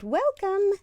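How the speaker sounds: high, sing-song welcome; witches welcoming. Acted. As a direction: greet like a friendly witch. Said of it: "The witches said".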